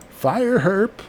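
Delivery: high, urgent call to open fire; urging the colonist to shoot; excited high call. Real, not acted. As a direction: yell the order up high.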